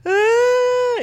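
very high, loud fake bawl; sustained squeal imitating a crying kid; mock child's wail. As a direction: burst into a hammed-up toddler cry. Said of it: "y empiezan a llorar".

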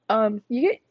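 talking through hiccups. Real, not acted. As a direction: hiccup mid-phrase. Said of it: "I have, like, the hiccups".

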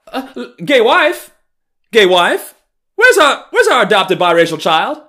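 over-the-top mock neighbor hunting for the child; shrieking mock theatrical call. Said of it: "so goddamn theatrical".

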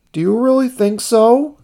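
very high, doubtful question; high character voice, unsure; Ernie's voice. A narrator's reading. Ernie asks uncertainly.

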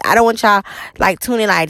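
ordinary talk

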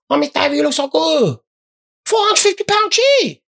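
put-on high, sing-song Chinese-accented salesman; imitated Chinese shopkeeper accent. Comic doing a Chinese shop seller's accent to the crowd. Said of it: "So, yeah, I impersonate people".

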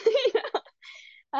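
high laugh; bright amused laugh. Real, not acted. Laughing at own joke.

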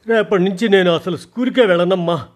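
tearful daughter refusing school, mock sobbing. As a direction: blurt it between sobs. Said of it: "ఏడుస్తూనే వెక్కిళ్ళ మధ్య".

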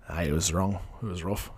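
low, soft Irish mumble; Irish accent. Acted. Host having another go at the accent, Irish. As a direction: try a quiet, low-pitched Irish accent. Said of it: "tried to do that, like, Irish accent".

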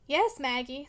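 ordinary delivery

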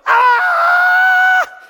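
very high, very loud mock scream; villain's death scream. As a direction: let out a hammed-up falling-man cry. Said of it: "you get to hear him go".

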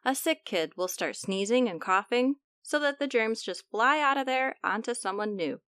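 ordinary delivery, nothing marked

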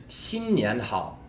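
新年好 in first, second, third tone; Mandarin tones. Shown as a demonstration. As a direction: say 新年好 slowly with clear tones. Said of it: "So 新 in the first tone".

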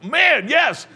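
high, loud burst of glee; exultant yell, hammed up; mock elated shout. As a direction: shout it like a sarcastic celebration. Mock elation at being damned.

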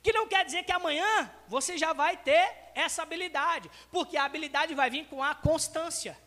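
ordinary talk, nothing marked

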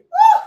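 loud high woo with a laugh; high laughing whoop. Real, not acted. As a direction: whoop with delight. Preacher's exclamation mid-sermon.

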